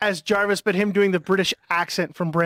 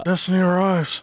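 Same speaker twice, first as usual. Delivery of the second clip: Nick Cage Thanos; 2 semitones lower than usual. Pretend. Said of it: "Nick Cage ... as Thanos".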